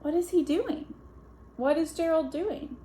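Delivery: ordinary delivery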